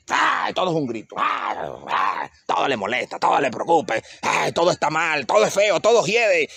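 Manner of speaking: mock whiny grumbling with sighing ays; bitter complaining. Imitating an embittered person who complains about everything, mock whiny. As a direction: play a sour person who hates everything, lightly.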